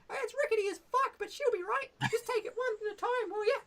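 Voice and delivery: brash goblin challenging strangers at the bridge. Shown as a demonstration. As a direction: squeak it out like a rude little goblin guard. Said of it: "'cause he's like".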